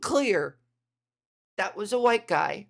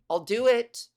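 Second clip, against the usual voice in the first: usual speaking voice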